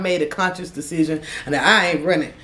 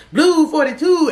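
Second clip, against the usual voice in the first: higher, louder play call; mock quarterback call; 9 semitones higher, 4 dB louder than usual. Teller joking they are a football team at the line, mock play call.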